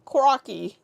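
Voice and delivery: exclaimed in a put-on Aussie accent; mock Australian accent. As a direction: pick a word to get into an Australian accent. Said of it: "get into the accent".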